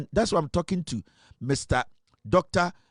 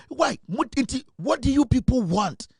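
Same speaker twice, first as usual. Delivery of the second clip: exasperated demand; 8 semitones higher than usual. Real, not acted. Demands answers from party people.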